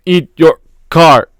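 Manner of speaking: like a broken radio transmission; crackling radio voice. A narrator's reading. Arnold's voice breaking up over the radio, crackling slow line. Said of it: "More crackling".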